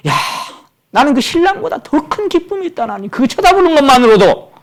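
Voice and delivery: high, exultant preaching; fervent preaching. Acted. Preacher voices the Baptist's joy to the congregation, fervent.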